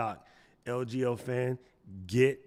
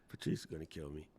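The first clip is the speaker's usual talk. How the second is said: ordinary talk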